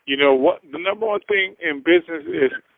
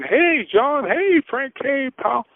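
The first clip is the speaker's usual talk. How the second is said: put-on high, cheery greeting of old pals; friendly greetings to pals, pitched high; chummy greetings; 10 semitones higher, slower than usual. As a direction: call out to friends like a regular.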